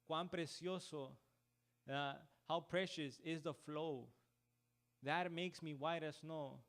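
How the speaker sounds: slow, lingering on the words; quoting hymn lyrics. Retold or imitated. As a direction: dwell on the hymn line slowly. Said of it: "Y en inglés dice". (citando el himno despacio)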